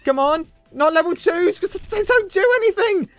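high-pitched frantic complaint; exasperated whining. Real, not acted. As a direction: whine in frustration at the game. Losing, complains at the game.

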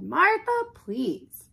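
high, loud "Martha" dropping to please; exasperated plea. Acted. Family could not stand it, exasperated. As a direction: cut in, fed up and begging.

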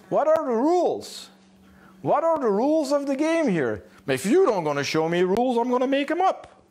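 high-pitched, insistent dog's voice; demanding dog. Acted. Dog to owner about missing rules, high demanding voice.

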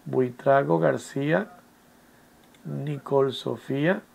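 slow, soft self-dictation; hushed, drawn-out name; talking while writing. Real, not acted. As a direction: stretch the name out quietly as you write it.

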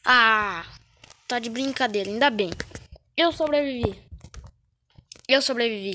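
loud relieved cheering; excited shouting. Real, not acted. Survived the round.

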